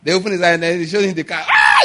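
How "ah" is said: high loud delighted shout; old man shouting with joy at the Jaguar; surprised cry. Retold or imitated. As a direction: gasp out loud at the new car. Shown the new car.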